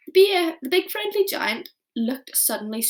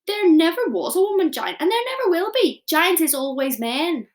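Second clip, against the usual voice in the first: higher, louder giant declaration; shouting; 6 semitones higher, 7 dB louder than usual. Acted. Giant shouting at Sophie, much louder and higher. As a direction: bellow it like a lasso swing. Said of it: "shouted the B F G".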